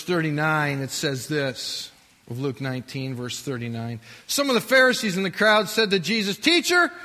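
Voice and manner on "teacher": loud, high, insistent demand. Acted. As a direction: push hard to shut them up. Pharisees demand silence.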